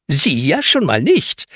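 butter the man up; little boy sucking up to the caretaker; fawning. Acted. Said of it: "schleimt sich Lasse bei dem Mann ein".

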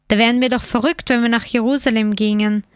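usual speaking voice

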